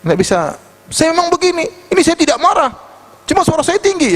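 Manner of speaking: imitating someone loud, pitch pushed way up; loud-voiced protester. Loud talker insisting he is not angry, high-pitched mock protest. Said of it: "dia suara keras kalau bicara, wah".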